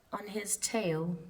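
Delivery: ordinary delivery, nothing marked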